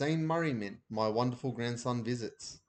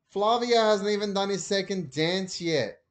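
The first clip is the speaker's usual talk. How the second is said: Mum on the phone; 8 semitones higher, 6 dB louder than usual. A narrator's reading.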